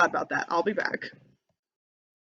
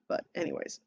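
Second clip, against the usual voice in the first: plain reading voice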